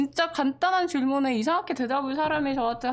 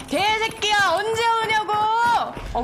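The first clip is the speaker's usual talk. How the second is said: high, loud exasperated yell at the friend; angry yell; 12 semitones higher, 5 dB louder than usual. Retold or imitated. Retelling yelling at a friend who never answers, furious yell. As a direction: blow up and yell the question. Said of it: "왜 소리 질러?".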